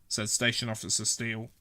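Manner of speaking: plain reading voice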